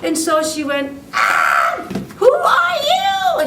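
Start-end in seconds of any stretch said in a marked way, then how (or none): 1.1-3.5 startled gasp then a high, loud frightened cry, drawn out. A narrator's reading. The princess crying out in fright at the woodcutter. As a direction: shriek it in fright at a stranger. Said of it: "very frightened".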